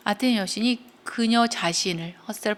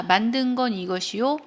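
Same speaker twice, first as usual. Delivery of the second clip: normal talking voice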